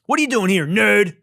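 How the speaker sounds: put-on high, taunting movie greaser picking on a kid; mock tough-guy taunt, pitched high; greaser bully. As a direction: sneer it like a fifties hallway bully. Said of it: "I feel like a greaser".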